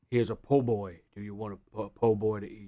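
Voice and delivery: low Cajun voice offering food; Cajun accent. Retold or imitated. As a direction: put on a deep Cajun voice. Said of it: "I'm still working on mine".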